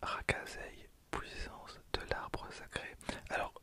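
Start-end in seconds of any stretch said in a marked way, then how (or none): none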